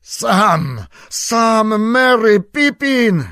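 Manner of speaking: loud, high frantic yells of names; panicked full-voice calling into the dark; wild shouting. Acted. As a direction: scream for them in fear. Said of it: "krzycząc dzikim głosem".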